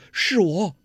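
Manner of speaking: a touch of startled joy; surprised and glad. A narrator's reading. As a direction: answer at once in happy surprise. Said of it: "又惊又喜， 忙说".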